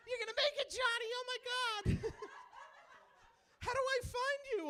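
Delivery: high mock mom voice; proud mom. Comic imitating his mom cheering him on, high squeal. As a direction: squeal like an excited mother. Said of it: "She's like".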